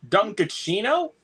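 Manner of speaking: high, slow drawn-out Dunkachino; quoted catchphrase. Retold or imitated. Friend echoing the movie catchphrase, high stretched voice. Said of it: "What's my name? Dunkachino".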